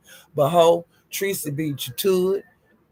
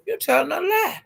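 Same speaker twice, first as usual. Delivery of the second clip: higher, rising, faster retort; host snapping back at Ness, higher and faster; calling her out; 7 semitones higher, faster than usual. Retold or imitated. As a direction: shut down the lie with rising heat. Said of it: "She said".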